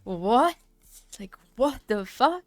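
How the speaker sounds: loud incredulous what; rising mock outraged what the fuck; retold disbelief. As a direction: act stunned and outraged. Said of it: "I was like".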